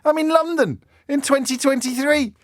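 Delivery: very high, incredulous whine of protest; exasperated disbelief. Real, not acted. Presenter in London in twenty twenty-three, exasperated by his topic. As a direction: protest the absurdity at high pitch.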